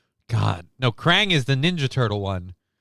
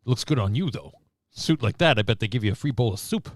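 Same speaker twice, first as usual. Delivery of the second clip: lightly voiced stand-up heckler; a hint of wisecracking comedian; Rodney Dangerfield. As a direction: toss out jokes like an old club comic. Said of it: "Did kinda go Rodney Dangerfield suddenly".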